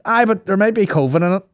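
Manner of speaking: high-pitched mock protest; insistent caller. Acted patient insisting to doctor on phone.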